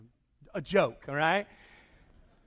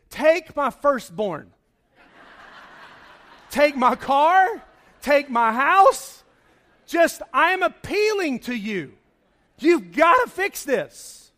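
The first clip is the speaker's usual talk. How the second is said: slow, loud, higher begging cry; desperate pleading shout; 4 semitones higher, 8 dB louder, slower than usual. Retold or imitated. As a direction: beg like you'd give away everything. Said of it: "a use of exaggeration".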